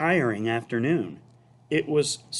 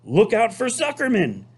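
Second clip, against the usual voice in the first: high warning gander yell; high mock gander yell; 11 semitones higher, 4 dB louder than usual. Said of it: "yelled the gander".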